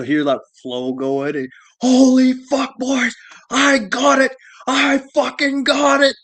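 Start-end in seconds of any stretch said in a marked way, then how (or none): 1.8-6.2 much higher, louder triumphant yell; imitating the detective's overexcited cry; excited shout. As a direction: shout it like you struck gold. Detective finds the crawl space.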